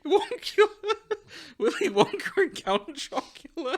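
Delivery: cracking up at the title; laughing. Real, not acted. Repeating the fic title to co-hosts, laughing.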